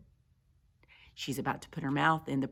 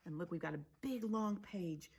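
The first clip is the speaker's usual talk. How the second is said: soft, near-whispered remark; hushed aside; 2 semitones higher, 13 dB quieter than usual. Real, not acted. Reader pointing out a long page, hushed. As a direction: show the listener something quietly.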